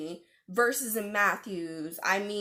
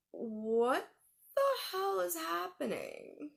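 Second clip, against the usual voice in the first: slow, drawn-out bewildered question; stretched-out, spooked what-on-earth; stunned disbelief; 7 semitones higher, 4 dB quieter, slower than usual. Retold or imitated. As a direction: pitch lifts high on a baffled question. Stunned reaction to strange fan.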